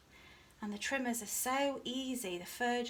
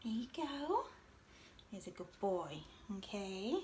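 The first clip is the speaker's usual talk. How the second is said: talking to a dog; 3 dB quieter than usual. Real, not acted. Praising dog Jimmy during trimming.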